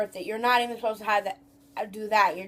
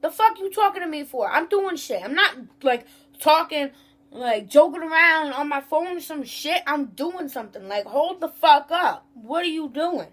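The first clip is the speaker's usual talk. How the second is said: higher-pitched, ranting comeback; acted indignant retort; 4 semitones higher than usual. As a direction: replay an exasperated telling-off you never said. Acts out indignant inner retort to customer.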